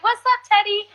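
high cheery greeting; playful high greeting; imitating herself. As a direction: sing out the hello. Own greeting voiced.

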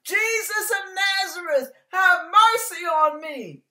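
high, loud plea; crying out. Acted. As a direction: cry out to Jesus over the crowd. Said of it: "I'm gonna open my mouth wide".

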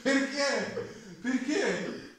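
high laughing question. Real, not acted. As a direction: laugh through the words. Laughing at translated comment.

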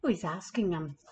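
plain reading voice

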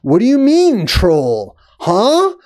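slow, high mock bafflement; mock-dumbfounded, pitch jumping up at the end; incredulous officials. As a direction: drag out the question like dim, baffled officials. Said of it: "And they're like".

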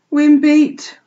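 loud, high, mournful country sheep; mournful old ewe. A narrator's reading. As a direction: lament it like a beaten old ewe. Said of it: "said Ma mournfully".

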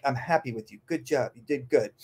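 soft, pleased praise for a good dog; gentle praise. Shown as a demonstration. Trainer showing owners how to praise. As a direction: tell the dog softly it did well. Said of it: "You say it like".

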